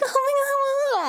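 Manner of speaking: squeaky, drawn-out mock swoon; giggly waitress. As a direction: imitate a smitten waitress, slow and very high.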